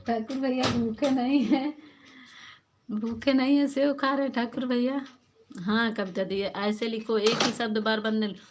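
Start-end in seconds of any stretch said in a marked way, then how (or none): 0.1-5.1 higher, louder teasing sing-song; playful teasing. Real, not acted. Teasing Thakur bhaiya for eating during the live, playful. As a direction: poke fun at him with a grin in the voice.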